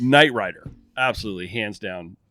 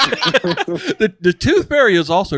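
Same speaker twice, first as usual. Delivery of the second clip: laughing; 7 semitones higher, 5 dB louder, slower than usual. Real, not acted. Laughing while speaking.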